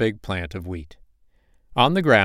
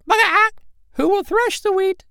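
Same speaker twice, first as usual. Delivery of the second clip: high, loud hopeful hen squawk; hopeful hen call; 5 dB louder than usual. Acted. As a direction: call out hoping someone helps. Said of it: "in a very hopeful tone".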